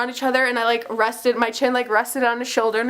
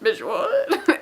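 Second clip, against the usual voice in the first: baffled reaction; 5 semitones higher than usual. Retold or imitated. Fans' baffled reaction, acted.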